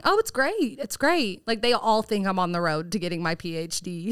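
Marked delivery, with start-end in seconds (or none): 0.0-1.5 much higher, bright breezy reply; chirpy fib. Retold or imitated. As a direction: brush it off cheerfully. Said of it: "how is your psychology degree going?".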